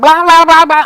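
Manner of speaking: high, loud mock screaming babble; imitated yelling. Uncle imitating his niece running wild, mock screaming. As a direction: imitate a kid screaming nonsense at full pitch. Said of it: "just yelling and screaming".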